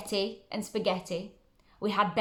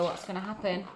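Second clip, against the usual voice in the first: plain reading voice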